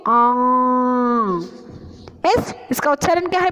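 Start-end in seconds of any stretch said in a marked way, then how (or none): 0.1-1.6 long nasal ã through nose and mouth; nasal aa sound. Shown as a demonstration. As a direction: hold आ as a nasalised ã, mouth open. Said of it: "इसका उच्चारण करते हैं".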